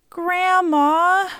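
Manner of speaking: put-on high, drawn-out child's plea; pleading whimper. A narrator's reading. Child begging her grandmother not to reject her, pleading whimper. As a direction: plead like a heartbroken little girl. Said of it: "pleaded with a heart-wrenching whimper".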